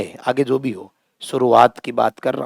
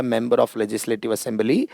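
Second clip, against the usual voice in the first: member of legislative assembly spelled out slowly and softer; slow softer full form; 5 semitones lower, 8 dB quieter, much slower than usual. Shown as a demonstration. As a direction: read out the full form slowly, almost aside. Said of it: "पूरा नाम तो कौन लिखेगा". (धीमे, हल्के स्वर में पूरा नाम)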